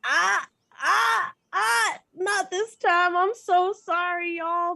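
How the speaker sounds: high, loud, drawn-out no-no; playful scolding refusal. Acted. Traveler telling druids not this time, high scolding refusal. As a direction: shush them with slow, high apology.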